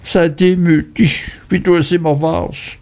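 high whining plea; high Mrs Heep lamenting to her son; lamenting mother.

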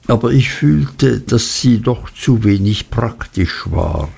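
plain reading voice

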